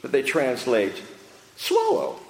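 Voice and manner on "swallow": high, prim, quick little sip request; mock overly genteel asking; dainty swallow. Preacher mocking the polite translation, high and prim. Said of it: "No, no, no".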